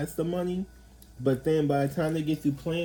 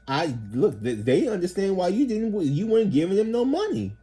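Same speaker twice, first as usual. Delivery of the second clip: worked up; 8 semitones higher, 4 dB louder than usual. Real, not acted. Heated retort after mock voice.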